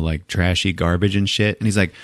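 ordinary talk, nothing marked

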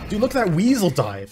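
ordinary talk, nothing marked

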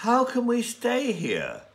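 high, exasperated wife; Thea's voice. Acted. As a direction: voice Thea as a fed-up young woman. Thea complains about hotel.

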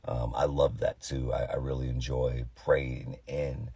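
low, tired, deep-ish talking voice; sleepy low voice, like Morgan Freeman. Real, not acted. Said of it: "I got tired voice right now".

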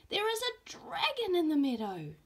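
put-on wide-eyed child reporting the huge dragon; excited little boy.

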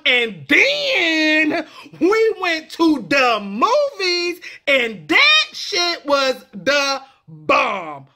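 drawn-out, slow "den", "da", "dat". Shown as a demonstration. Vlogger telling a movie story, slow, TH as D. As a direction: stretch "then", "the", "that" with D sounds. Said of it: "it becomes a D A".

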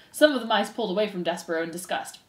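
ordinary delivery

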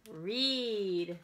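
bright, expressive picture-book reading; reading to children. Acted. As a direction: read it out to a circle of little kids. Librarian reads picture book aloud.

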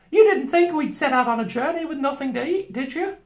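high housewife gently chiding; Missus Beaver. A narrator's reading. Beaver wife chiding the children, high and unbothered. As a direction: chide them in a high motherly voice.

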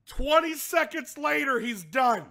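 loud, high, laughing disbelief; incredulous punchline. Real, not acted. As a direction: shout the punchline, cracking up. Gleeful punchline of the story.

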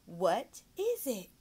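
"What is it?" said very slowly, each word drawn out; slow, deliberate "What is it?"; underlined. Shown as a demonstration. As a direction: stretch "What is it?" out slowly for a child. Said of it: "I always like to underline".

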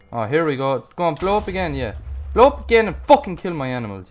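loud furious outburst at the game; angry yelling. Real, not acted. Gamer yelling at a creeper that kills his animals, furious. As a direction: yell at the creeper in real rage.

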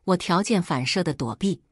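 ordinary delivery, nothing marked

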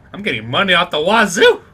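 loud, excited gamer exclamation; gleeful boast. Real, not acted. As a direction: crow loudly over the winnings. Celebrating a payout in game.

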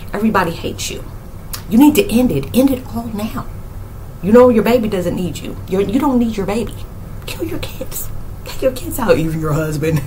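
soft, insinuating mock voice; negative inner voice. Voicing negative talk after a spiritual awakening, hushed and insinuating. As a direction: imitate a quiet voice planting dark thoughts. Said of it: "you start having negative talk".

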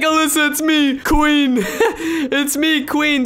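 high, laughing through the words; reading her message while cracking up; laughing while quoting. Retold or imitated. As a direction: giggle out the name. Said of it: "She's like".

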